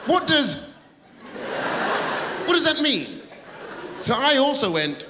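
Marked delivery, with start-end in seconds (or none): 0.1-3.2 high baffled taxi driver. Retold or imitated. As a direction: play the puzzled cabbie, pitched up. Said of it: "he went".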